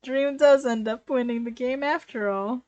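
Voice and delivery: usual speaking voice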